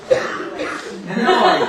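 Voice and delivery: loud laugh into a slow question; hearty laugh. Acted. Producer laughing with old girlfriend, loud and jolly. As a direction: laugh it off, then ask.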